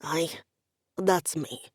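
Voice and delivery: a lightly voiced deep Highland brogue; calm Scots officialdom; low Scottish confirmation; deep officious Scottish. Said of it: "the deep and officious voice replied".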